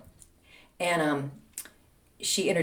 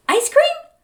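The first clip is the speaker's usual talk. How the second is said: very high, loud, sing-out question; chirpy offer; 7 dB louder than usual. Retold or imitated. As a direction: imitate mom suddenly all sweetness. Mother's cheerful offer repeated.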